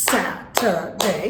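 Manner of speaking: "স্যাটারডে" split as sa-tuh-day; syllable by syllable. Shown as a demonstration. Teacher clapping the word for students, syllable by syllable. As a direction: slowly clap out "স্যাটারডে" in separate syllables. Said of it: "clap দিয়ে".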